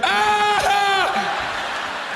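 high startled yelp at a crying driver; hammed-up gasp of alarm; mock shocked shout. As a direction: yell in fake alarm. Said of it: "people are gonna be like".

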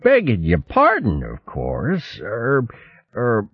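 high, dithering, hemming and hawing; fussy old hare hinting he is hungry; stammering old hare. Acted. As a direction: bluster politely while angling for food.

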